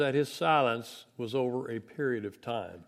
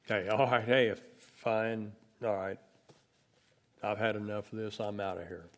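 giving up; 5 semitones lower than usual. Retold or imitated. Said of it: "I'd cash in the chips".

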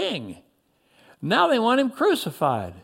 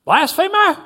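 high, loud accusing cry; shout; 10 semitones higher, 6 dB louder than usual. A narrator's reading. Someone in the crowd condemns the prisoner, shouting. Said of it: "someone shouted".

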